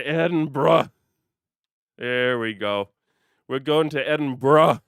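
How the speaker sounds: Edinburgh repeated as ED-en-bra, loud, laughing between tries; bra ending. Shown as a demonstration. Streamer practising the bra ending for chat, loud. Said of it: "Just use the bra effect every time at the end".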